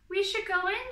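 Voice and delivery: loud, high urgent call; nervous shout to friends; anxious shout. A narrator's reading. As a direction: call them in over the wind. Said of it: "shouted Franklin".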